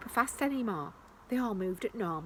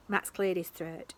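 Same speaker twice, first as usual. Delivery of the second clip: usual speaking voice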